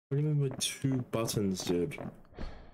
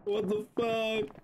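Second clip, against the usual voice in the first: shocked disbelief; 11 semitones higher, much slower than usual. Real, not acted. Confused in game.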